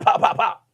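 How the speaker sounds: unvoiced pop pop pop; mock gunfire sounds; gunshot noises. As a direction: spit out three gunshots. Imitating gunshots.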